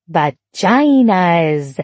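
long drawn-out cheeky shout; gleeful shout. A narrator's reading. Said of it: "Emma shouted as she skipped".

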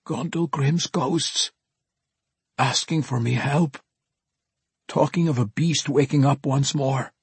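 awestruck dwarf. A narrator's reading. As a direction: report it in dread and wonder. Awestruck dwarf reports the ghosts.

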